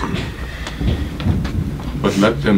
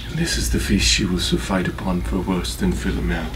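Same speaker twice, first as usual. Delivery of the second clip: low, hushed vow of revenge; quiet menace; 4 semitones lower, 5 dB quieter than usual. Acted. Avenger readies the killing, low and hushed. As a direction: drop right down after the outburst.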